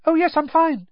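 put-on frightened boy insisting he is fine, pitched far up; high gabble. A narrator's reading. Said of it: "Neville gabbled".

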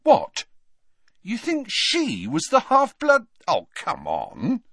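high-pitched incredulous outburst; amused disbelief. A narrator's reading. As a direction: scoff at it in laughing disbelief. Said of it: "He burst out laughing".

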